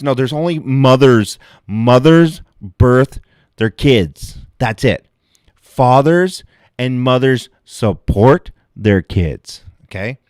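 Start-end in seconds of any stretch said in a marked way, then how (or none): 1.7-9.6 slow, loud, each word hammered; emphatic slow insistence. Acted. Comic to the crowd, slow emphatic ranting. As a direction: lay it down slowly like law.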